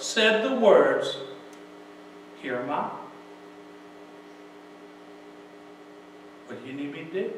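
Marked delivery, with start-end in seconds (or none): 2.4-7.3 low, soft humble answer; quiet submission. Retold or imitated. Moses answering God's call, quiet and submissive. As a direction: answer softly, offering yourself.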